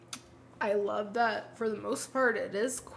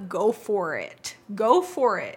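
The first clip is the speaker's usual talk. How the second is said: emphatic; 9 dB louder, slower than usual. Real, not acted. Urging listeners to act.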